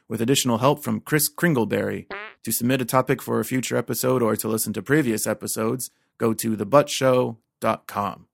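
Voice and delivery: ordinary talk, nothing marked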